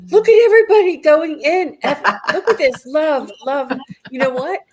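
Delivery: high, loud and gushing with real delight; thrilled. Real, not acted. Host to her viewers, thrilled and laughing at the flood of love. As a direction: burst out, overjoyed at the flood of messages, laughing a little. Chat fills with hashtag love.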